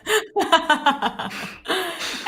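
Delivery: loud, high helpless laugh; high laughter. Real, not acted. Still laughing at the eval call, high and loud.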